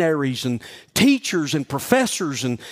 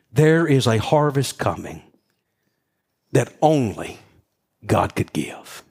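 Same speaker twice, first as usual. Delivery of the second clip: preaching; 7 semitones lower, slower than usual. Acted. Sermon climax.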